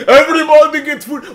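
put-on very high, loud Egyptian-accented yell. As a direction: shout over the cashier as an Egyptian customer. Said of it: "just a general Egyptian accent".

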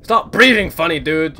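high, loud protest; scared scolding. Real, not acted. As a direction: tell off the thing that scares you, loudly. Scolds scary game character.

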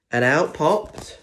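ordinary delivery, nothing marked

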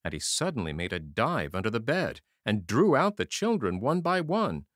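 ordinary delivery, nothing marked

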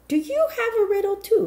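high, friendly rabbi's son; a light smiling young man. A narrator's reading. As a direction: lift the voice, smiling and curious. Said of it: "he smiled".

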